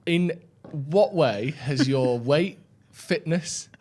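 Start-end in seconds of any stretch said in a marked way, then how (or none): none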